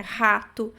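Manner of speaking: "Rato" said HA-to; Portuguese aspirated R. Shown as a demonstration. Teacher gives a Portuguese example, aspirated R. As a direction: start "Rato" with an h sound. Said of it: "que nem a gente faz no português".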